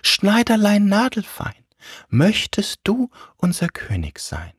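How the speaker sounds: high-pitched singsong summons; calling rhyme voice. Acted. A voice asking the tailor to be king, high singsong. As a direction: chant the little rhyme high.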